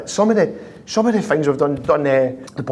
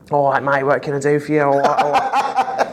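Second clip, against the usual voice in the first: lightly put-on impression of a mate answering the phone; casual mimicked phone reply, breaking into laughter; Jimmy Bullard. Teller mimics prank victim taking the call, light impression. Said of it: "Jimmy to a T".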